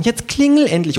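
high, impatient kangaroo. Acted. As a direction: urge him on as the kangaroo. The kangaroo speaks. (hohe, ungeduldige Känguru-Stimme)

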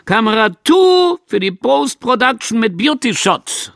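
high loud call across the set; supervisor calling out. Acted. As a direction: shout the order to the crew. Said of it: "und gerufen". (Supervisor ruft laut zum Team)

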